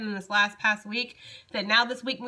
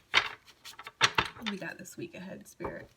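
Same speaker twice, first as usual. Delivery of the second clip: slow hushed whisper, almost breath; whisper to Spirit; 2 semitones lower, 5 dB quieter, slower than usual. Real, not acted. Reader asking Spirit for the week's colors, slow whisper. Asks Spirit during tarot reading.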